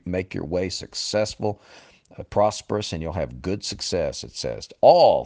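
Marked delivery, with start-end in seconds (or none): 4.8-5.2 "All" punched out loud; stress on "All". Real, not acted. Preacher driving home the missed word, "All" stressed loudly. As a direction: hit "All" hard as one loud word. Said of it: "I emphasize that word every time".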